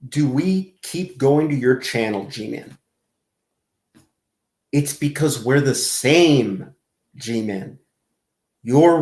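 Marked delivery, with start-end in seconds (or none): none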